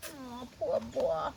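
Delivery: slow, tender pity; sympathetic. A narrator's reading. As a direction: coo it sadly to the children. Sympathy while reading.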